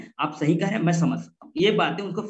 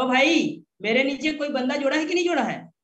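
annoyed demand; 7 semitones higher than usual. Retold or imitated. Angry recruit phoning the seller.

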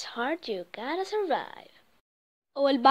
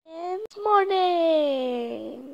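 drawn-out; 6 semitones higher, much slower than usual. Acted. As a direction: stretch it very slow and high. Dramatic drawn-out announcement.

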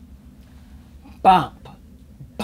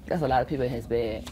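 quick aside; 7 semitones lower, 4 dB quieter, much faster than usual. Real, not acted. Fast aside.

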